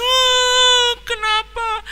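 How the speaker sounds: put-on very high, wailing boy's voice; wailing child. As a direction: cry harder like a frightened child. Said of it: "sambil menangis semakin keras". (anak merengek keras sambil menangis)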